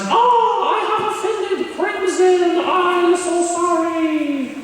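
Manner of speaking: high, slow squeaky lament; witchy apology. Retold or imitated. Imitating the teacher apologizing to Crimson. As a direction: wail it like a tiny old witch. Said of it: "she's like".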